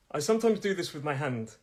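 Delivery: ordinary talk, nothing marked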